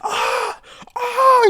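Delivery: hammed-up agonized screams; imitated screaming. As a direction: yelp like a man being burned. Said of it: "He's, like, screaming".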